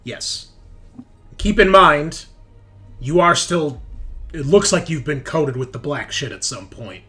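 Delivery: usual speaking voice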